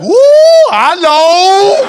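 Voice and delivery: very high, loud whoop; hammed-up falsetto excitement; delighted woman shopper. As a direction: squeal a drawn-out greeting. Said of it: "She's like".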